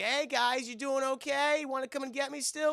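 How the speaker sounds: high-pitched mock-innocent kid; taunting. Kid in the clubhouse to the burglars, high taunting.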